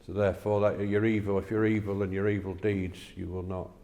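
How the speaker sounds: usual speaking voice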